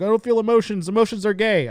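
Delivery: high, loud mock-tough voice; mocking macho guys. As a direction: parody the guys who deny feelings. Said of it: "you guys are like".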